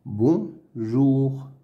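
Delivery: "Bonjour" as "bon", pause, "jour"; syllable by syllable. Shown as a demonstration. Teacher sounding out words to read, "Bonjour" in two pieces. Reading drill, split then blended.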